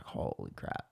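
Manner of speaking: very low, hushed mock awe; barely voiced, retold disbelief; stunned hush. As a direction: murmur it under your breath, imitating shock. Stunned man sees a ghost, retold.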